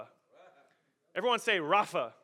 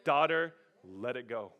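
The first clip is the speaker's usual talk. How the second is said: God's gentle voice; 6 semitones lower than usual. Acted. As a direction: soothe a child gently. God gently speaking to believer.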